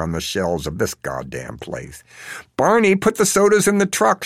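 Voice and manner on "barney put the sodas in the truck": high, loud barked order; a Baltimore dad yelling an order to his son. A narrator's reading. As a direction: order the kid to load the car. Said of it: "she hears Dad order".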